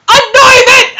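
high, loud cry of disbelief; incredulous shriek. Real, not acted. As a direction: shriek the wrong word in disbelief. Shrieks at the misspelt word.

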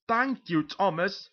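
high, loud, grateful exclamation; delighted. Acted. Photographer thanking Thomas for his spare camera, delighted.

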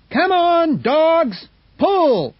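high, loud driver's shout. A narrator's reading. Hal urging the dogs to pull, loud shout. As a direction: yell the dogs forward. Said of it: "he shouted".

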